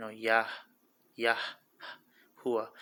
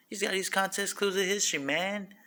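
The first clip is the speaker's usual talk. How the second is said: put-on higher quick voice; 9 semitones higher than usual. Repeats the line in a put-on voice.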